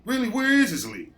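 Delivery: high, loud, fed-up shouting; irritated boss losing patience over the radio; exasperated yell. Retold or imitated. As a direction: blow up at whoever keeps calling on the radio. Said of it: "I'm like".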